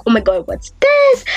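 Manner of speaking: sudden high alarm; shocked. Retold or imitated. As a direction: jolt up in alarm. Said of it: "So I'm just like".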